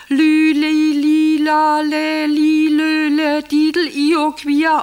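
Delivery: loud, high monotone chant; excited birdsong code. Acted. Wife answering the code in her agitation, loud birdsong chant. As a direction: chant nonsense like a singing bird, insistent and even. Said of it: "muss in der Aufregung".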